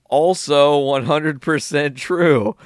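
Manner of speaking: comic emphatic repeat; drawn-out punchline. Real, not acted. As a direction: stretch each word out for the joke.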